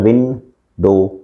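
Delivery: Window slowly as two separate beats, विं, then डो; slow syllable-by-syllable demo of window; split into syllables. Shown as a demonstration. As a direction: break window in two with a clear pause between syllables. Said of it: "टू times".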